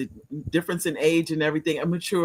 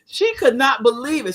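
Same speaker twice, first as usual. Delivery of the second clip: ordinary talk, nothing marked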